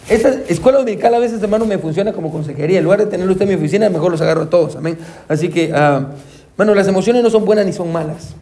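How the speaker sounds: usual speaking voice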